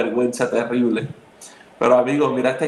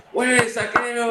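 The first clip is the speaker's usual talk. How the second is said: mock cheer; 9 semitones higher, slower than usual. Mock movie slow-clap cheer.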